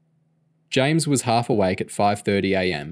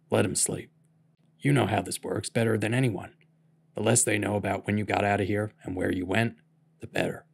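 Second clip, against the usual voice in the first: put-on hushed old man; 5 dB quieter than usual. A narrator's reading. Bill hushed, kids sleeping.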